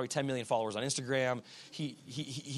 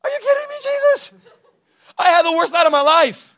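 very high, loud outburst of disbelief; panicked Peter; 16 dB louder than usual. Acted. Exhausted fisherman answering Jesus's cheerful request, high panicked shouting. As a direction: shriek it in frantic exasperation. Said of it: "Can you imagine the panic".

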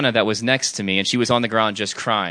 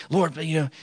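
high, softened anxious plea; mock fearful prayer; 4 semitones higher, 5 dB quieter than usual. Imitating intercessors praying out of fear, mock fearful plea. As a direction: pray timidly in a raised, put-on voice. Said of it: "they're praying out of fear the whole time".

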